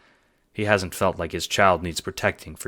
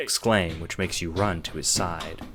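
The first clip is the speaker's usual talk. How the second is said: ordinary delivery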